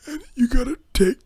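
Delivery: put-on high, whimpering, half-whispered old man's plea; mock-sobbing old customer insisting on his return; whiny old man. Old customer begging the clerk to take the bulbs back, hammed-up high whimper. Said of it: "And he'd be like".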